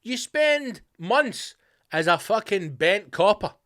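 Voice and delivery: ordinary talk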